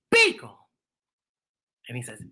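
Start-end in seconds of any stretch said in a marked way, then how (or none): 0.1-0.6 high, loud eager name call; girl naming him. Acted. Alice pointing at Beagle, high eager call. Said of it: "she points at him and she goes".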